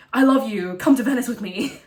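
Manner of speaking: loud, insistent declaration of love; loud pleading. Retold or imitated. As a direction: plead loudly and eagerly. Thomas love bombing again.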